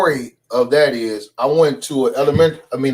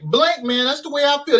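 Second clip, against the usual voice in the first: higher put-on teacher voice; Mister Oliver impression; 10 semitones higher than usual. A kid doing the gym teacher catchphrase for classmates. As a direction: deliver his catchphrase in the teacher's voice. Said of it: "known around the school for doing Mister Oliver".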